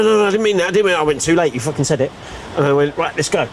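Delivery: ordinary talk, nothing marked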